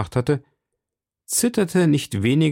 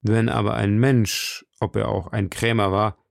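ordinary delivery, nothing marked

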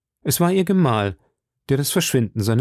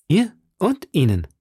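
higher-pitched, heartfelt exclamation; eager exclamation; 7 semitones higher than usual. A narrator's reading. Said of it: "rief D'Artagnan".

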